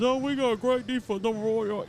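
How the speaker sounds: high mocking voice, sarcastic; mocking boast. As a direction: mimic their cocky bragging.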